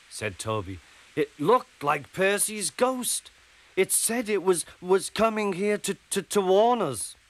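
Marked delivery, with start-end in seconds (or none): 1.2-7.2 scared engine reporting a ghost; frightened stammer. Acted. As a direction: stumble over the words in fright.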